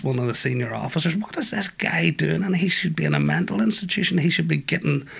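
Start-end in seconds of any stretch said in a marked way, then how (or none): 1.2-5.1 retelling an officer's exasperation in a higher voice; exasperated officer. Retold or imitated. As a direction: let the officer's frustration push the pitch up. Frustrated officer quoted.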